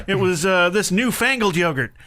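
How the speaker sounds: ordinary talk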